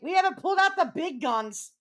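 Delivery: high, loud boast; loud high bragging threat. Acted. Riled up threatening rivals.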